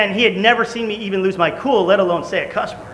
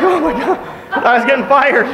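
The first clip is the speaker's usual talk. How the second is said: laughing relief; 7 semitones higher, 3 dB louder than usual. Real, not acted. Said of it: "relief, laughing".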